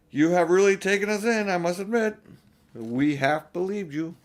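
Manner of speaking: put-on older brother ribbing his sister about the hoax; Peter, lightly voiced. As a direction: tease her with a grin. Peter teasing Lucy.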